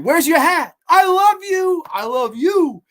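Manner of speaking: high, loud overjoyed calling; Adrian's cry. Retold or imitated. Adrian calling to Rocky after the fight, loud and high. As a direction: shout it across the ring to him.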